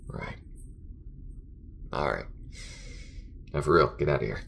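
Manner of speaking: grudging compliment, then tired venting; halting, worn-out talk to a partner; fed up but easing into banter; weary space crewmate. Acted. Acted character talks with crewmate.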